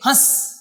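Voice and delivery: हंस as a short "hãs", no separate n; quick, short vowel. Shown as a demonstration. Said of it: "जल्दी से बोलेंगे".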